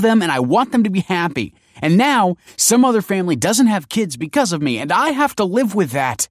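loud, high, tearful outburst; welling up. A narrator's reading. Kelsey defends what she did for his family, welling up. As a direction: defend yourself hotly, close to tears. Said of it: "welling up".

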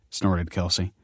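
ordinary delivery, nothing marked

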